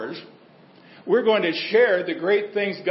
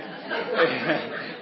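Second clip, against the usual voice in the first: soft laugh; 4 dB quieter than usual. Real, not acted. Laughs at own joke.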